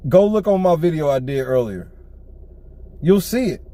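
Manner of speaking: usual speaking voice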